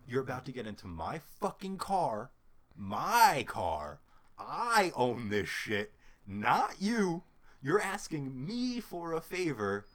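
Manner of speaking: loud, emphatic retold telling-off; indignant scolding. Acted quarrel with sister.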